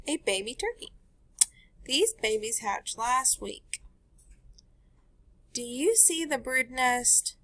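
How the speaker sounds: bright storybook read-aloud; teacher reading a picture book to the class; reading to children. Acted. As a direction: read it to a class of little kids.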